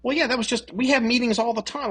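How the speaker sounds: high-pitched mock self-justification; imitating an official explaining himself; defensive. Acting senator answering investigators.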